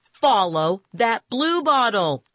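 high, slow, emphatic shout; urgent shout. A narrator's reading. Boy shouts at his friend to chase the bluebottle, urgent shout. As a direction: shout it out word by word. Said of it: "I shouted".

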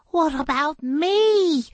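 high, drawn-out whiny protest; Sal indignant. A narrator's reading. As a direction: whine for some credit. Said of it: "Sal said".

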